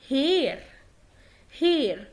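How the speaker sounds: "هير" with a clear H at the start; sounded initial H. Shown as a demonstration. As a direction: begin "هير" on a fully breathed H. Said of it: "لو الها جت في أول الكلام بتنطق ها". (نطق "هير" بهاء واضحة في الأول)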